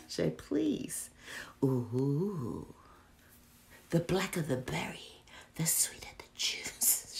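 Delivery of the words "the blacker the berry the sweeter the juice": hushed whispered movie line; whispered quote. Retold or imitated. Quoting a movie to viewers, whispered. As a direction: breathe it out like a secret.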